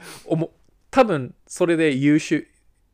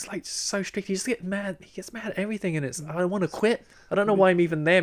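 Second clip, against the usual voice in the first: higher-pitched imitation of complaining; retold dissing rant; 5 semitones higher than usual. Student dissing the basketball coach at an exam, retold rant. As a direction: reenact a running gripe in English. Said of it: "英語でめっちゃディスりまくってましたね".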